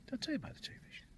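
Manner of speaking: hushed, jokey aside; playful whispered reminder; mock-confidential whisper. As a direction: whisper it like a cheeky secret brag. Repeats his brag as an aside.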